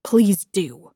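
curt, spiteful dismissal; spat. A narrator's reading. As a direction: spit out the polite words. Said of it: "I spat".

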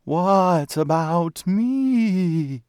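very slow, high ghostly wail; disembodied ghostly voice. A narrator's reading. Unseen voice behind the undertaker's shoulder, ghostly wail. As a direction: wail it out like a spook, drawn long. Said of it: "a disembodied voice echoed softly".

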